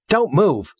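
sharp command. Acted. Armed girl orders the house, high sharp command. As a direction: stop her dead with it.

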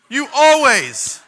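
very slow, loud and high accusation; angry rebuke. Acted. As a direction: hammer the word always. Stephen's rebuke voiced.

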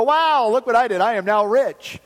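loud, high mock boasting; boastful servant. Servant given the king's gold, mock boastful and excited. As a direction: crow with amazed self-congratulation.

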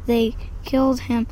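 usual speaking voice

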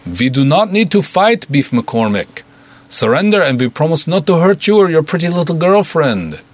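put-on a Russian goon bargaining in thick Russian accent; heavy Russian accent. A narrator's reading. Russian goon calling through the door, heavy Russian accent. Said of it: "dour, heavily accented voice".

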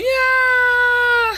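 a high, drawn-out imitated moan of yeah; mock moan. Reviewer voicing the bitten guy, hammed-up high moan. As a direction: ham up a victim's pleasured yeah, way up high. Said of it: "you just hear him going".

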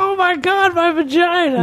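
high mocking girly squeal; mocking girl voice. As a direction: mimic a shrieking gamer girl. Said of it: "They're like".

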